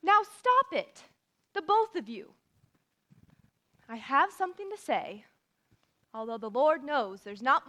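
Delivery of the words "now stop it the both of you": sharp command. Acted. Breaking up a quarrel.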